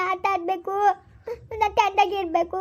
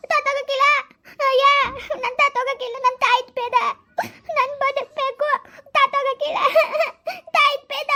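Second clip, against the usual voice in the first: put-on high, childlike tearful protest; panicked refusal in short high bursts; frightened pleading; 7 semitones higher than usual. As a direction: beg desperately for your life. Girl begs not to die.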